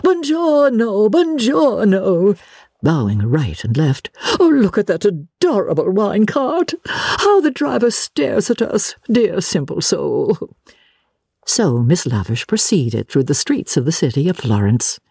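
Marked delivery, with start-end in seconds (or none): 0.0-2.4 high, slow, drawn-out singsong; grand retort. Acted. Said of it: "was the retort". 4.4-10.6 high, effusive lady's voice; gushing lady tourist. Acted.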